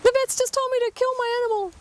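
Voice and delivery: imitating a distraught pet owner on the phone; panicked client. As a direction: blurt it out in a frantic rush. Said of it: "ring me up saying".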